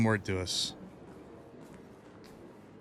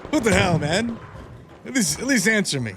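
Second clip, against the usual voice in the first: calling out; 6 semitones higher, 4 dB louder than usual. Real, not acted. Calling out to other player.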